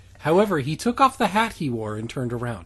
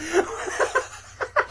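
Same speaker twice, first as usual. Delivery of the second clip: high squealing laugh. Real, not acted. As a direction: shriek with laughter. Cracking up.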